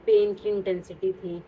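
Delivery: ordinary talk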